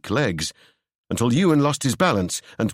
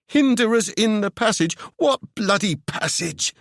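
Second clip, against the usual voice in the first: high, exasperated voice; exasperated Seb; 11 semitones higher than usual. Acted. Seb demanding Ewan explain the passage, high and exasperated. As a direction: demand it incredulously.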